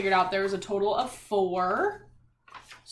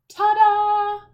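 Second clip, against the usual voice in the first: big, high, loud ta-da; reading to kids, a high, loud ta-da; excited ta-da; 10 semitones higher, 10 dB louder than usual. Acted. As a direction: present it like a magic trick. Teacher reveals balanced page.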